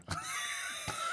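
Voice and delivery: sudden high wild laugh; burst of laughter. Real, not acted. Comic laughing at his own Norfolk spit.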